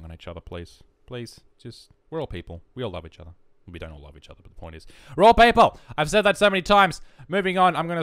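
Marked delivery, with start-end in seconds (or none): 1.1-2.6 quiet plea. Real, not acted. Vlogger asking people to stop hating, quiet plea. Soft plea, later repeated loudly. 5.1-7.0 loud, high outburst; emphatic yell. Pretend. Vlogger hammering home the point, emphatic yell. Same words yelled for emphasis.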